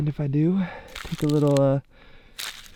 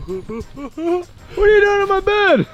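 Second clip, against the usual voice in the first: mock outrage; slower than usual. Finds friend in his bed.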